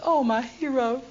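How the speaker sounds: high, sugary gush; mock adoring. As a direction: fawn over him as if innocent. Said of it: "she says".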